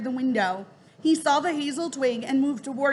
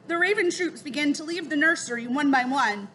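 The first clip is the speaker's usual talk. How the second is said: usual speaking voice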